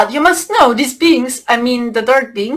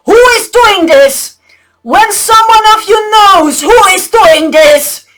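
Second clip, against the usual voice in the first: louder commanding call; loud demand; 5 semitones higher, 6 dB louder than usual. Retold or imitated. The dracos demand the slaves name the culprit, loudly. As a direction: order the slaves to come forward. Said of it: "This is what they said".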